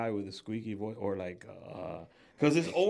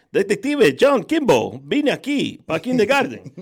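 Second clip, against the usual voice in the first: much higher, louder dubbed Arnold line in Spanish; 8 semitones higher, 10 dB louder than usual. Retold or imitated. Host showing a Spanish dub of Kindergarten Cop. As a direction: ham it up like a Spanish voice-over. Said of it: "You see the names in English".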